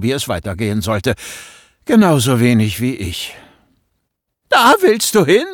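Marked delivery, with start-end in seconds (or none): 4.5-5.4 much higher and louder, voice cracking in alarm; your voice breaks with disbelief. Acted. Boy panics at his friend's plan, voice cracking. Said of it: "Meine Stimme überschlug sich".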